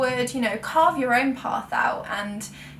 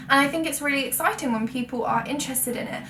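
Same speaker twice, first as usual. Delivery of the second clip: ordinary talk, nothing marked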